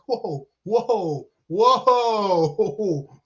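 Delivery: drawn-out mock dismay; mock stunned reaction. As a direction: stretch each word in mock disbelief. Mock stunned reaction to tragic joke.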